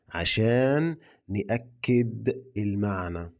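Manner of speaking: slow, clear model line for learners; slow repeat. Shown as a demonstration. Teacher repeats the phrase slowly for learners, slow and clear. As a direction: say it slowly so students copy. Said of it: "يلا نقولها تاني".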